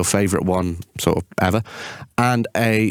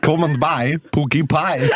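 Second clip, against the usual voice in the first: mock Finnish accent; 4 semitones higher, slower than usual. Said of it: "nowhere near a Finnish accent".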